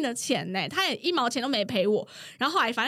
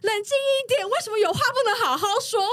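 quick, high mock plea to calm down; lightly voiced male coworker begging them to stop; coworker pleading; 10 semitones higher, 3 dB louder than usual. Coworker begs the two fighters to calm down, fast and high. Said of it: "我那个男同事就说".

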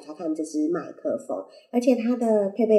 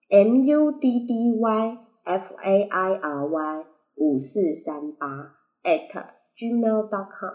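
spelled out slowly; slower than usual. Shown as a demonstration. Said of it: "我知道你记不住".

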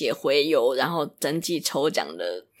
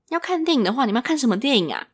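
high and louder, overly friendly question; much higher, louder mock sales chirp; nosy saleswoman; 8 semitones higher, 4 dB louder than usual. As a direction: mimic a saleswoman prying cheerfully. Saleswoman pressing about the movie.

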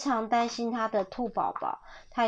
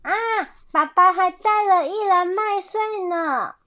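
put-on high, loud child-bunny squeal; gleeful high-pitched exclaiming; happy cry; 8 semitones higher, 6 dB louder than usual. A narrator's reading. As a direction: cheer like a delighted little bunny. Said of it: "兔宝宝高兴地叫".

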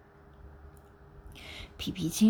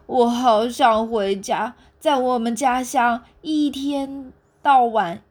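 slow, louder, longing for home; homesick longing; 4 semitones higher, 6 dB louder, slower than usual. Acted. 猴子对企鹅诉说想家，缓慢而响亮地倾诉思乡之情. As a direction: draw it out like a homesick monkey.

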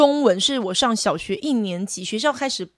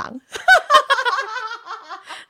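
bright falling laugh, pitched well up; high laugh; 12 semitones higher than usual. Real, not acted. Co-host laughing at her own joke about being from 真卡. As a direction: burst out laughing at your own line.

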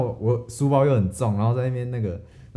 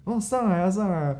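high, cheerful urging; kind auntie; 9 semitones higher than usual. Retold or imitated. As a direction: wave the boy in brightly. Auntie invites boy into car. (阿姨高声招呼上车)

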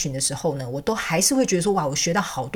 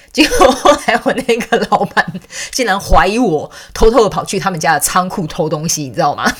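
laughing; 7 dB louder than usual. Real, not acted. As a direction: giggle through the punchline. Laughing at boss's suspicion. (笑着讲)

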